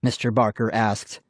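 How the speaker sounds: ordinary delivery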